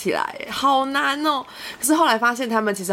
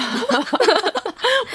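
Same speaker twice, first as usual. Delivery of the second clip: 大笑; 14 semitones higher than usual. Real, not acted. 恍然大悟大笑.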